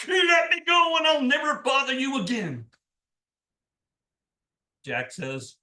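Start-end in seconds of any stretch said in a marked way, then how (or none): 0.1-2.7 loud, high yelled plea; devil begging release. A narrator's reading. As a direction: yell it while poked all over by thorns. Devil caught in thorns begs release.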